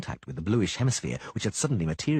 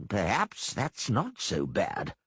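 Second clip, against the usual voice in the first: slow, cool pondering voice; musing chancellor; much slower than usual. Acted. Gillenstiern muses over their fall, slow cool voice. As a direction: muse aloud with indifference. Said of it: "Gillenstiern wondered".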